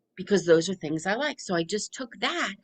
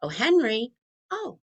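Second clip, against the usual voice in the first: gentle pausing talk; 6 semitones higher than usual. Shown as a demonstration. Parent gently noticing the rain with Henry, unhurried. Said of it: "go from noticing silence to".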